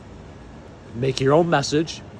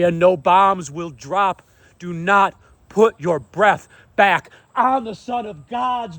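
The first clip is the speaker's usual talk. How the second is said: raised, emphatic preacher's delivery; fervent proclamation; 4 semitones higher, 3 dB louder than usual. Real, not acted. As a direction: proclaim it forcefully, phrase by phrase.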